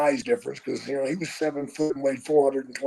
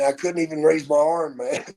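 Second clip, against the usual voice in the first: usual speaking voice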